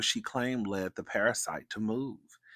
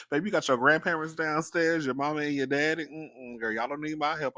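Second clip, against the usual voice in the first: higher, louder mock refusal; sassy retold brush-off; 7 semitones higher, 6 dB louder than usual. Aunt to screaming niece downstairs, playfully dismissive. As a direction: wave the child off, voice raised in play.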